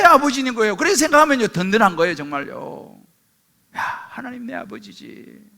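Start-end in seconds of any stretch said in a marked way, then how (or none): 3.7-5.5 slow, very soft musing; quiet marvelling. Acted. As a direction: savour it slowly, almost to yourself. Savours the thought after rousing point. (되뇌듯 느리고 아주 작게)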